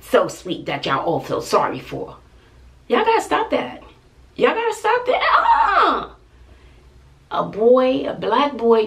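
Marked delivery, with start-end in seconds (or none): 2.9-3.8 scolding viewers. Real, not acted. Scolding viewers who pity son. 4.4-5.2 high, scolding through a laugh. Real, not acted. Mother laughing as she scolds viewers who pity her son. 5.4-6.1 sharp, very high uh-uh; emphatic no. Real, not acted. Mother refusing viewers' pity for her son, very high uh-uh.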